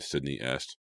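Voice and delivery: ordinary delivery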